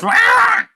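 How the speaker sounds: sudden high shrieking squawk; monster squawk. Acted. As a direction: let out a beastly beak screech. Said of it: "he just goes".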